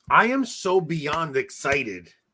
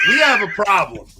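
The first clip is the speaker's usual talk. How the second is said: angry rant; 3 semitones higher, 7 dB louder than usual. Real, not acted. Announcing a problem to co-hosts, angry. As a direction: sound the alarm, worked up. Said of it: "I'm angry".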